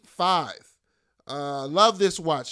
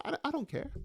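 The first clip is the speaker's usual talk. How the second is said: soft, dropped-away mutter; 8 semitones higher, 9 dB quieter than usual. Acted. Shrugging off the bad show to viewers, muttered softly. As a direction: mutter it under your breath.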